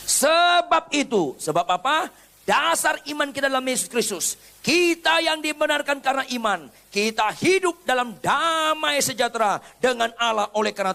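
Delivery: high, ringing preacher's proclamation; rising pulpit fervour; fervent preaching. Acted. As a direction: proclaim it to the whole church. Preaching at full force.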